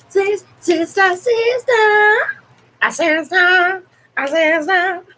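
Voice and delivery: very high, sung-out calling; sing-song it up high, playfully; high singsong call. Pretend.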